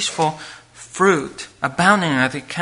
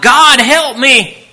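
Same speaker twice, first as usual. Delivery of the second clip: desperate plea; 8 semitones higher, 8 dB louder, much slower than usual. Retold or imitated. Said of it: "they want".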